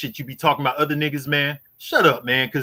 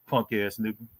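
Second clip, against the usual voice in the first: muttered insult; 8 semitones lower, 11 dB quieter than usual. Real, not acted. Insulting rival on livestream.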